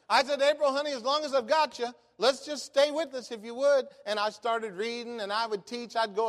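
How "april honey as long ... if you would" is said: coaxing the caller. Retold or imitated.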